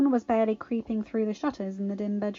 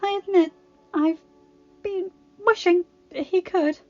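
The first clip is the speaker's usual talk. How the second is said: strained; 10 semitones higher, 7 dB louder than usual. A narrator's reading. Belle strained in labour.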